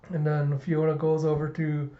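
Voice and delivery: ordinary talk, nothing marked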